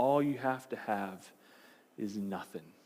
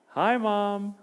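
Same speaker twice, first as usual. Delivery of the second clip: cheery wave to mom; 9 semitones higher, 5 dB louder than usual. Retold or imitated. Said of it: "They wave their hand".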